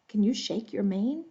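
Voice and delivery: ordinary delivery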